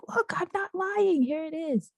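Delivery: high-pitched retold insistence; insistent. Showing sister the letter as proof, acted insistence.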